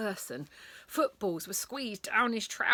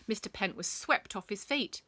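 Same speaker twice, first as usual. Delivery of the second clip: usual speaking voice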